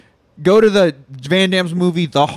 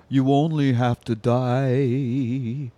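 slow solemn; 5 semitones lower, 3 dB quieter, much slower than usual. Retold or imitated. Solemn Jesus line slowed.

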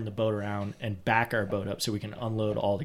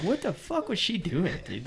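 high, soft incredulous question; mock bafflement; 7 semitones higher, 7 dB quieter than usual. Storyteller retelling their talk about her that night, mock bafflement. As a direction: replay the disbelief in a raised, hushed pitch.

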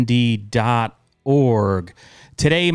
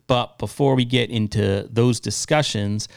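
ordinary delivery

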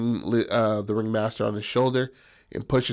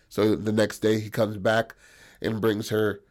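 normal talking voice

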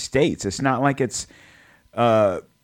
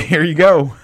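higher, louder acted 'here you go'; confident handover; 7 semitones higher, 7 dB louder than usual. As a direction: hand it over readily, pitched up and louder. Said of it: "I'm gonna be like".

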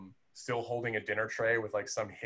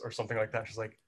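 ordinary delivery

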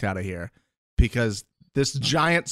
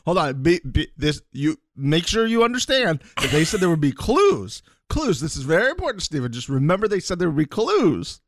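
higher, urgent imitation; insistent warning; 6 semitones higher than usual. [name] prepping Steven before he watches, mock-urgent. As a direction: drill it in, hammering CLUES.

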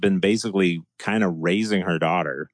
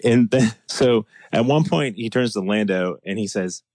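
normal talking voice

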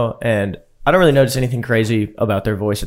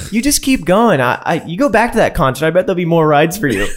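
high mock girl talk; 6 semitones higher than usual. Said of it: "and she's like".

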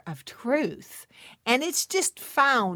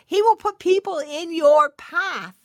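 much higher, louder, pushing the word will; emphatic restatement; 7 semitones higher, 9 dB louder than usual. Shown as a demonstration. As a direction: proclaim it like a promise. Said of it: "to speak into those dreams".

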